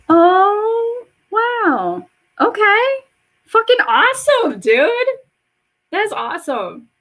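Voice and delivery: high, loud delighted surprise; wowed, pitch shooting way up; amazed reaction. Real, not acted. Reacting to a viewer's news.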